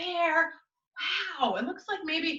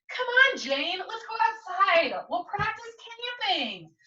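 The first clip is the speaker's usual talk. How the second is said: put-on higher, eager kid brother voice; pesky little brother; 8 semitones higher, slower than usual. Little brother Sam pestering his sister Jane, higher and eager. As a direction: nag your sister to come play.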